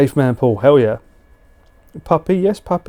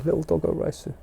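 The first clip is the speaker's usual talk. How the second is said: soft hush for dog; 2 semitones higher, 14 dB quieter than usual. Real, not acted. Softly naming the sleeping dog.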